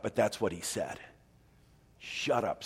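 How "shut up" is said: low-key stern command, softly spoken. Acted. Jesus to the demon, stern and quiet. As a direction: order it quietly and firmly. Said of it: "said Jesus sternly".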